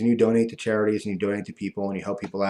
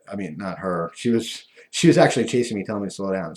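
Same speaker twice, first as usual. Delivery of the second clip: normal talking voice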